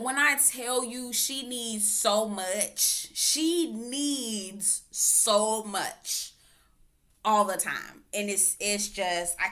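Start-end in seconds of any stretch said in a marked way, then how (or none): 3.1-6.3 very slow, stretched emphasis; drawn out. Shown as a demonstration. Stressing how needy she is, drawn-out slow. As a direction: drag each word out. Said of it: "repeats slowly".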